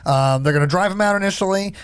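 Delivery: ordinary talk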